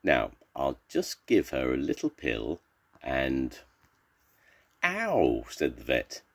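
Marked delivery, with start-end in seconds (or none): 4.8-5.5 high "ow" of pain; storybook cry of pain, pitched up; acted yelp of pain. A narrator's reading. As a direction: yelp as if suddenly hurt. Vet hurt by the cat.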